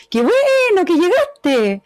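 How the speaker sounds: high, slow, sweeping tune on "Qué bueno que llegaste"; long upward melody. Shown as a demonstration. Teacher models the warm informal melody for the class, high and slow. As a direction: lift "bueno" high and draw the phrase out slowly. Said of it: "Bien para arriba, la prosodia".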